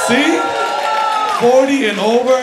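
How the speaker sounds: high, loud hype callout; crowd shout. Real, not acted. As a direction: fire up the over-forty crowd.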